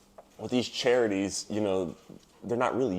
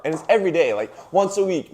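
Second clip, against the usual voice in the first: louder, higher, fed up; indignant rant; 8 semitones higher, 8 dB louder than usual. Real, not acted. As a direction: vent the irritation. Ranting about daily solicitors.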